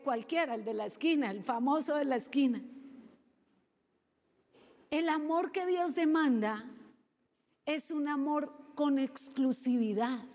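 usual speaking voice

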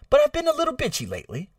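slightly British-sounding, high lilt; British accent. Real, not acted. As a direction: let a British accent slip in, high and quick. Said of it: "I sounded British right there".

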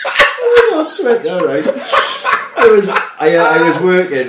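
high, loud, laughing through the words; helpless laughing. Real, not acted. Telling his mate about watching it on Twitter, helpless laughter. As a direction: struggle to tell it while cracking up. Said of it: "I'm laughing my fucking head already".

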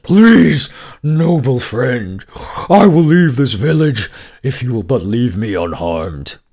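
a cowed beast begging for mercy; frightened pleading. Acted. Dragon afraid, pleading with the merchant to spare him. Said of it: "The dragon became afraid".

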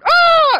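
mock girly scream. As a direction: shriek like a startled girl on the ride. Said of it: "screaming like girls scream".

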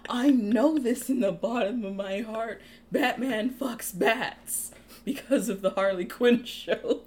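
loud, high, hammed-up proclamation; mock earnest declaration. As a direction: declare it like a zealous fanboy. Mock fan declaring Batman's habits.

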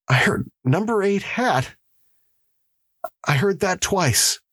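high-pitched, fearful report; frightened prisoner. Acted. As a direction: report it in high, haunted distress.

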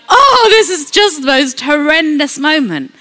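mock dismayed wail, starting loud; lightly hammed-up dread; voice of fear. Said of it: "we listen to that voice of fear".

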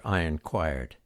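ordinary delivery

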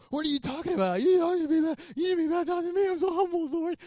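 a lightly hammed-up John the Baptist, high and protesting; high-pitched humble protest; flustered John. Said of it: "John's like".

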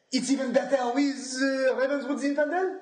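haughty wine expert voice; pompous sommelier recommending wine. Pretend. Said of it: "Is this, is this a sommelier voice?".

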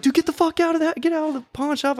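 high-pitched mock yell; frantic order in a high voice; retold panicked yell. Yelling at intruders in retold nightmare.

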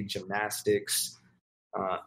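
ordinary delivery

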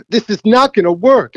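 fussy golfer voice pitched well up; needy golfer. Retold or imitated. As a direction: whine it like a picky player. Caddy voices needy golfer's complaint.

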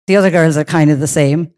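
usual speaking voice

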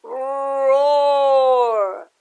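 long loud roar; huge drawn-out storybook roar; lion roar. Acted. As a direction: bellow like a trapped lion. Trapped lion roars.

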